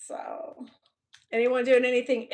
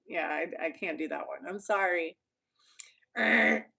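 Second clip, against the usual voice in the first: ordinary delivery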